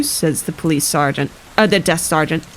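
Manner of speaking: ordinary delivery, nothing marked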